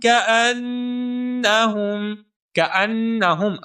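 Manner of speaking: كَأَنَّهُمْ with the doubled nun held nasally; doubled nun with ghunna. Shown as a demonstration. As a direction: lean on the نّ in كَأَنَّهُمْ and hum it. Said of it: "نون مشدد کے اوپر بھی غنہ ہوگا". (كَأَنَّهُمْ میں نون مشدد پر غنہ)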